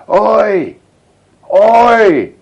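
loud high shouted hail in the dark; calling out. Retold or imitated. Corporal calling to the trenches in the dark, loud high shout. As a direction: yell it out across no-man's-land.

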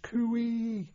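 high, drawn-out bush call; high-pitched Australian cooee; Aussie cooee call. Retold or imitated. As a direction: call it high across the hills. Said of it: "the old Australian call, you know, high-pitched".

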